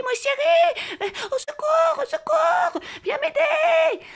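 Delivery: high, loud, stretched scream for help; screamed cry for help. Retold or imitated. Calling the partner from the garden in panic, shrieking. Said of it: "Alors j'ai crié, j'ai crié".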